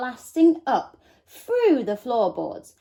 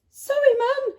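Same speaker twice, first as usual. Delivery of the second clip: yell; 5 dB louder than usual. A narrator's reading. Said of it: "yelled Laura".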